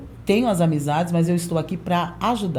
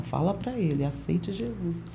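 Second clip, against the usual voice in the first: inner Holy Spirit voice; 8 dB quieter than usual. Retold or imitated. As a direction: murmur it gently like a voice inside the heart. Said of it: "Espírito Santo falando no meu coração".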